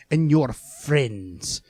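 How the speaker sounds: put-on drawn-out villain line with a Scottish accent; mock Scottish-accented emperor; Palpatine. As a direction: stretch it slowly in a Scottish accent. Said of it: "it's very Scottish".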